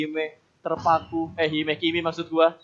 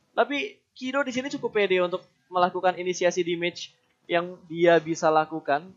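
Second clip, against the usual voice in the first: ordinary talk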